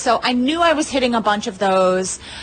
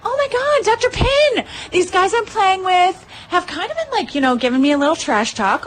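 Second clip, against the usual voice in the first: excited gush; 9 semitones higher than usual. Retold or imitated. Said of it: "I'm like".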